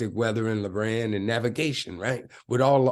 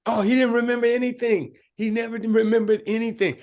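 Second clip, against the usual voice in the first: high, lively and louder, amused at the memory; amused disbelief; 11 semitones higher, 6 dB louder, faster than usual. Real, not acted. Laughing recall of sergeant.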